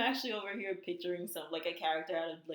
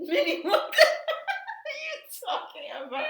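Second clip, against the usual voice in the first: high, loud cracking-up exclamation; laughing disbelief; 9 dB louder than usual. Real, not acted. Co-host baffled by host's cartoon description, laughing hard. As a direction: shriek the question through laughter.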